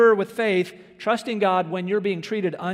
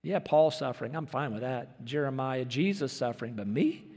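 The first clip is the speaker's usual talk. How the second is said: prideful mock; 6 semitones lower, 7 dB quieter than usual. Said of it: "a very prideful statement".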